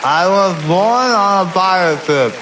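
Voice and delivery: slow and slurred, tongue pinched; holding tongue with fingers. Shown as a demonstration. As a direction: drag it out holding your tongue. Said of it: "if you hold your tongue and say".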